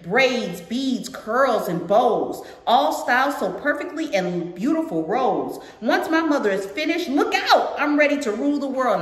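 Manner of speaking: put-on lilting read-aloud to a young audience; storybook narration with singsong rhythm; picture book read-aloud. A narrator's reading. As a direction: read it out brightly, line by line.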